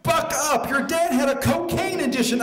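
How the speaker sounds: high, forceful rebuke; exasperated scolding. Acted. Sugar addict to crying friend, exasperated. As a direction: tell her off in a high, worked-up voice.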